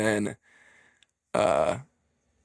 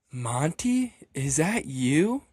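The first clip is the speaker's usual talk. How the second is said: high, drawn-out disbelieving question; slow incredulous question, retold; mock shock; 3 semitones higher, much slower than usual. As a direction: act out a crowd stunned to see him. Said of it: "in shock. They're all like".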